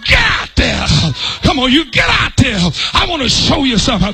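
booming divine command; commanding God. Acted. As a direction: push them out the door.

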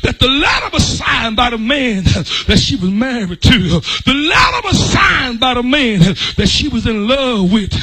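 rising, shouted preacher's cadence; fiery pulpit preaching. Acted. Preaching at full force.

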